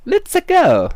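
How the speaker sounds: very high, loud game-hero cheer; squeaky, exuberant video game catchphrase; Mario. Acted. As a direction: shout it out in a high cartoon voice. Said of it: "the voice from Mario sixty-four".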